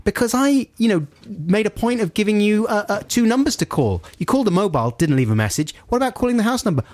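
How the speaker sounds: reliving the phone row, voice climbing; retold anger. Scolding the installer in high retold irritation. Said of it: "I'm getting really angry now".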